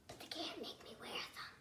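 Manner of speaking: whispered stubborn promise; hushed, defiant whisper. Acted. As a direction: whisper it like a secret rebellion. Defiant aside in a story.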